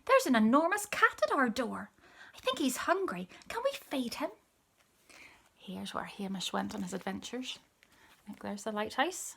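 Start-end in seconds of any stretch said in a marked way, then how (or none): 0.0-4.3 put-on little boy. A narrator's reading. Little boy asks his mum.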